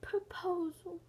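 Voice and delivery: unvoiced whisper; whispered answer. Answering favorite movie in a whisper. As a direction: breathe the word like a secret.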